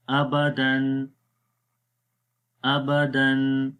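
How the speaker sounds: ابدن very slowly, smooth, no jolt; calm, no jerk. Shown as a demonstration. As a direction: read ابدن gently, nothing stretched. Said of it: "تو بالکل آرام سے پڑھنا ہے، جھٹکا بھی نہیں دینا۔". (آرام سے بغیر جھٹکے کے)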